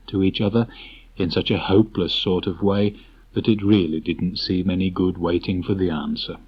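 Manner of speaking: ordinary delivery, nothing marked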